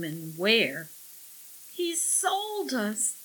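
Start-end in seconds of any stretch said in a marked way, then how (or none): 1.7-3.1 high, slow, tearful voice; tearful sniffing. A narrator's reading. Millie tells Jake they were sold, sniffing tearfully. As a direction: draw it out miserably, high and sniffly. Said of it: "Millie sniffed".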